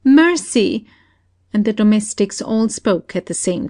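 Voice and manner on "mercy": high, loud pleading scream. Acted. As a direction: beg for mercy at the top of her voice.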